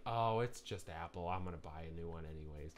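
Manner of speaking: soft, low, resigned mimicry; throwaway imitation, dropping low and quick; mock indifference. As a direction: mimic a shrugging customer, trailing away quietly. Mimics indifferent Apple buyers.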